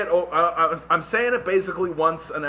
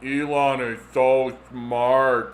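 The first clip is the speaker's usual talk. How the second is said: slow, low, trance-like echo; hypnotized fan, dazed; 3 semitones lower, 4 dB louder, much slower than usual. Retold or imitated. Hypnotized phone user repeats praise, slow trance voice. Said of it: "making people go, like".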